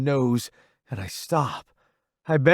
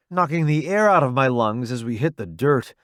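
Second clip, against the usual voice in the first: ordinary delivery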